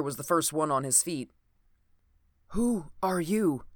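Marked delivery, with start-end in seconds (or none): 2.5-3.6 slow, wary stranger's voice; a hidden man asking slowly; voice from the corner. A narrator's reading. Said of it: "asked a voice from one corner".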